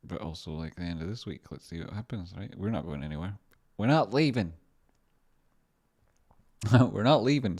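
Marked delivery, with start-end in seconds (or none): 3.8-7.6 loud, high catchphrase; mock defiance. Streamer refusing to leave, mock-defiant shout.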